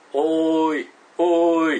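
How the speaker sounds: loud drawn-out call across the mountain; distant searcher calling out loud. Retold or imitated. Rescuers calling from afar.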